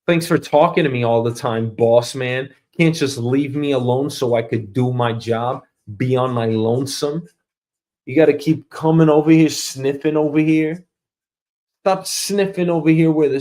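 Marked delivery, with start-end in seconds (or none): none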